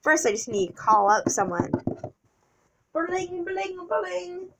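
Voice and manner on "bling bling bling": high, soft ringing noise; soft little high-pitched brring; imitated phone ring. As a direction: mimic a ringtone lightly.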